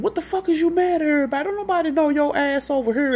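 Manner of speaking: high, fast retold yell at a man who started a fight; angry yell. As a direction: fire it off fast and high, mock furious. Poochie yelling at Amp in fight.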